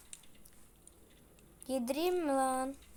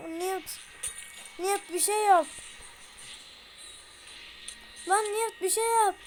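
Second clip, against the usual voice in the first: yelling for help while being attacked; panicked plea; 5 semitones higher than usual. Real, not acted. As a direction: beg for help in real panic. Begging companion during attack.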